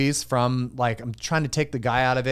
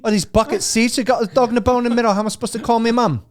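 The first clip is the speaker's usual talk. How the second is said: much higher mock Cockney accent; hammed-up Cockney; 7 semitones higher than usual. Friend keeps the Cockney Bond riff going, high mock Cockney. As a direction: rant like a London cabbie. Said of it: "Cockney James Bond".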